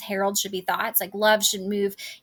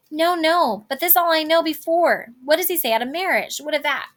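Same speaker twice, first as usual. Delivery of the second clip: impatient; 6 semitones higher, 4 dB louder than usual. Acted. Juliet impatient for news of marriage.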